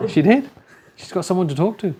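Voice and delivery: high, loud laugh through the words. Real, not acted. Preacher laughing at the lonely lady's joy. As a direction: crack up as you say it.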